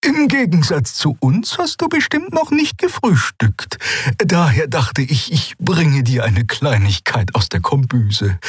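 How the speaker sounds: high-pitched character voice, good-humoured; cheerful Xea figure. A narrator's reading. Friend bringing pilot breakfast from the galley, cheerful. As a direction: offer it cheerily in a lifted voice.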